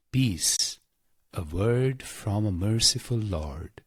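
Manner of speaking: usual speaking voice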